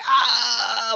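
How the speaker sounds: a high startled "Ah" of panic; hyper aroused cry. Shown as a demonstration. Trainer showing a hyperaroused client, mock startled cry. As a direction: act out a jolt of fight or flight. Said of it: "which are super excited".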